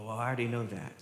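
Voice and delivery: low, soft mock know-it-all; quiet, unimpressed mock reply; dismissive listener. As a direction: lightly imitate a congregant who has heard it all. Voicing a listener who dismisses it.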